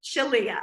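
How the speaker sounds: "Shalia" as shuh-LEE-uh; correct pronunciation. Shown as a demonstration. Host echoes the confirmed name, "Shalia" said shuh-LEE-uh. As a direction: say "Shalia" with a stressed LEE in the middle. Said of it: "you said it perfectly".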